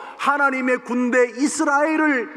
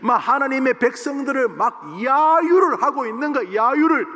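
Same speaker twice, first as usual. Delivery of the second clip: ordinary talk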